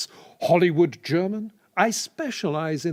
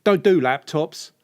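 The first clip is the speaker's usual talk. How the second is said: working-class builder, pushed out. Pretend. Offering a client a repair, as a builder. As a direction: put on a handyman's matey patter. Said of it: "builder not doing it for you".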